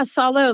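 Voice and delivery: "Salo" with the first syllable like "saw"; name pronounced SAW-low. Shown as a demonstration. Guest teaches host her name, "Salo" said as SAW-low. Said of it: "like S A W L O W. Like I saw".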